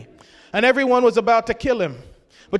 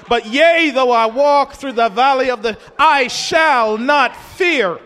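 raised preaching cry, slowing hard at the end; bold proclamation; 2 semitones higher, 4 dB louder, slower than usual. Retold or imitated. Preacher defying the shadows over his life, bold loud proclamation. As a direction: proclaim it loudly, then land each final word slowly.

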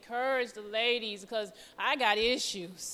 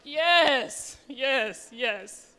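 crying out yes; 7 dB louder, much slower than usual. Retold or imitated. Finally answering the Lord.

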